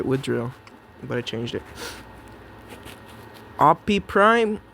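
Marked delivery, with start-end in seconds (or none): 3.6-4.6 drawn out. Pretend. Drawn-out playful name call.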